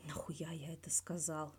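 slow hushed whisper of regret; whispered thought. A narrator's reading. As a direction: breathe it out as a private thought. Inner thought of the character.

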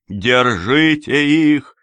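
high, loud, drawn-out yell; distant shout. A narrator's reading. Rubinstein calling after the fugitives, distant shout. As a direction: shout slowly as if from far off. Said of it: "кричал уже где-то вдалеке".

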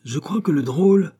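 high, indignant cry; outraged exclamation; furious. A narrator's reading. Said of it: "s'écria l'abbé furieux".

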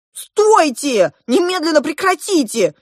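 high, loud command to stop; urgent shout. A narrator's reading. Girl to boys fighting over her, shouting. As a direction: cry out to break up a fight.

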